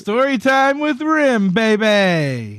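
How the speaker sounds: high, drawn-out announcer whoop; playful, stretched-out jingle-like announcement; hyped segment intro. Pretend. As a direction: sing out the segment title. Hyped mock intro to a story.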